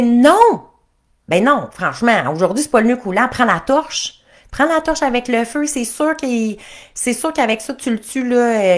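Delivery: high, loud nagging princess; bossy princess. Acted. Princess telling the prince to take the torch, loud and high.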